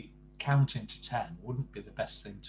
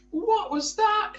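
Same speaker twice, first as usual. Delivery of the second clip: high, shrill-pitched shriek of terror, loud and slow; terrified shriek; 9 dB louder, slower than usual. Acted. Mister Jelly shrieks at a snapping twig, terrified. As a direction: shriek it out, jumping out of his skin. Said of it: "he shrieked".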